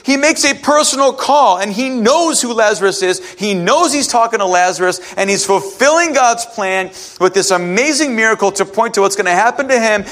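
usual speaking voice

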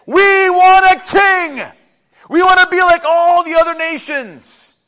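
high, very loud crowd-like demand; demanding shout. Acted. Israelites arguing with God for a king, shouted demand. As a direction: shout it out, stretching the words.

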